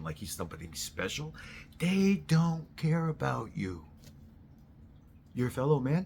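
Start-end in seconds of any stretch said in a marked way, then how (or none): 1.8-3.8 higher and louder, every word weighed; slow emphatic warning. Real, not acted. Warning voters about politicians, slow emphatic higher warning. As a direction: hammer it home slowly.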